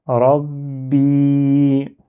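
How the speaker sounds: Rabbi with a held B and a long, sustained final i; prolonged final vowel. Shown as a demonstration. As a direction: stretch the i of "Rabbi" on a steady note. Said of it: "Il sert à prolonger".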